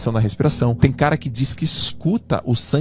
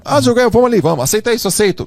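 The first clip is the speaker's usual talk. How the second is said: much higher and louder, coaxing mock voice; pushy friend imitated; 10 semitones higher, 4 dB louder, faster than usual. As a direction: imitate a friend pressuring you to come along. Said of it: "Eu não conseguia dizer não". (imitando um amigo insistente, agudo e alto)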